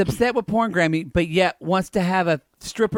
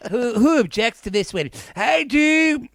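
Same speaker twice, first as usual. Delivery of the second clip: higher-pitched bawdy granny character; Porn Granny; 6 semitones higher than usual. Acted. Porn granny crashing a wedding, bawdy higher voice. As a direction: play a raunchy old granny, pitched up. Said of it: "looks like Porn Granny is not coming".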